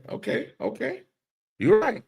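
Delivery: high, excited agreement; high-pitched excited yes. Real, not acted. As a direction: jump up in agreement.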